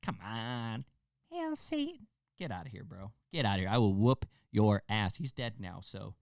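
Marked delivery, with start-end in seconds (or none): 1.3-2.1 tiny, very high falsetto; mock wimpy squeal, sky-high and softer; mocked killer's voice. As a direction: squeak it softly in falsetto to ridicule him. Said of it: "But not the, come on".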